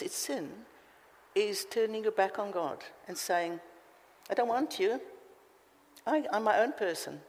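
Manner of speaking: ordinary talk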